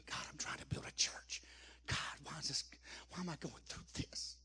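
imitating a broken whispered plea; whispered prayer. Grieving husband praying to God in his pillow, whispered. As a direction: breathe it out like a man praying alone at night. Retold desperate prayer while crying.

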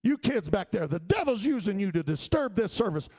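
put-on high, loud pastor scolding the kids; angry pastor. Pastor scolds chattering teens in the back, angry shout. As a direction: bang the pulpit and shout them down. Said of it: "and he said".

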